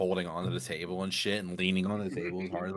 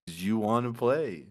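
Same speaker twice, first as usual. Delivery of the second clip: slow, stretched-out question; odd-talking bar guy; much slower than usual. Retold or imitated. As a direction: imitate the bar guy dragging his words. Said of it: "this guy's then talking to me".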